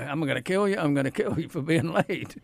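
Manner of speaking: normal talking voice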